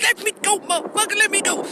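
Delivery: high-pitched mock protest; imitated struggling woman. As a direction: mimic a girlfriend demanding release, voice way up. Acting out woman held by wrist.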